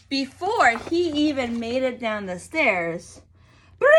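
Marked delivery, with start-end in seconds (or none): 3.8-3.9 a sharp mock phone ring. Narrator imitating the phone, sharp ring. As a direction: ring like a telephone. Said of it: "The phone rang".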